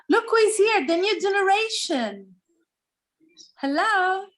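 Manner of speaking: high, excited welcome; delighted greeting. Real, not acted. Greeting a child who joins.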